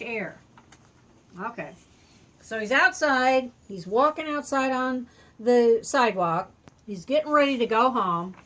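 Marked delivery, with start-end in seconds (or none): none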